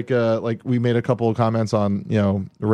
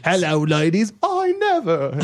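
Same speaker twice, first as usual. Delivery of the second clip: very high prim gasp; scandalized falsetto; prim shocked lady; 5 semitones higher than usual. Pretend. Groucho style joke.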